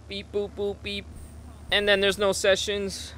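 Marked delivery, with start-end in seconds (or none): 0.1-1.1 soft mock beep boop; computer beeps. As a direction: play a little computer thinking. Imitates computer loading sounds.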